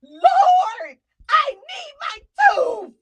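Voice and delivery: high, loud cry-shout, slow on "I need my tooth"; a loud sobbing plea from the shower; frustrated crying shout. Retold or imitated. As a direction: wail it up to the Lord in desperation. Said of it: "I got so frustrated".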